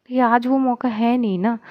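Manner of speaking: usual speaking voice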